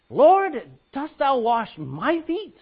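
loud, high, slow disbelief; astonished Peter. Acted. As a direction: call out Lord in shock. Preacher acting Peter's question.

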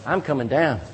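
normal talking voice

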